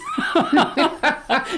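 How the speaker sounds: high delighted laugh at herself; burst of laughter. Real, not acted. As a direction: laugh out loud, amused at yourself. Laughing at own metaphors.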